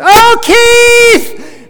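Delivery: high, very loud starstruck shriek; excited fan yell. Retold or imitated. Lady spotting a rock star in the lobby, excited yell. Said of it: "and yells".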